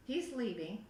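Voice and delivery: usual speaking voice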